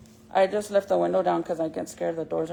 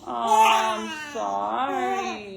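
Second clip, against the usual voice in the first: tender apology; 3 dB louder, much slower than usual. Real, not acted. Mom crooning sorry to crying baby, very slow.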